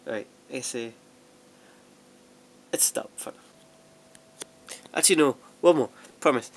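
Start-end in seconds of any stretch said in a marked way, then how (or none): 2.7-4.8 whisper. Real, not acted. Wrapping up, hushed aside.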